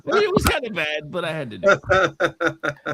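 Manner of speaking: laughing through the words. Real, not acted. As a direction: chuckle out an admission. Laughs at own bad joke.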